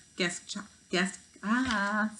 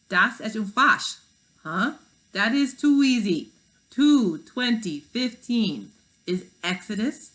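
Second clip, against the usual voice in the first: put-on slow, louder German-accented hologram; 5 semitones higher, 7 dB louder, slower than usual. Gutenberg answers Miguel's question, German accent. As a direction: spell out the verse in a heavy German accent. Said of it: "said a man with a German accent".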